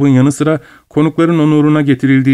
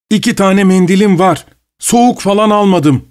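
calling after her; 8 semitones higher than usual. A narrator's reading. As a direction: shout after the departing woman. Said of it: "diye bağırdı Pavel Pavlovich arkasından".

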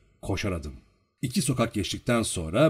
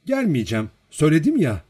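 raised voice, higher at the end; loud, insistent protest; loud refusal; 6 semitones higher, 7 dB louder than usual. A narrator's reading. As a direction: refuse loudly, pushing up on the last words. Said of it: "dedi yüksek sesle".

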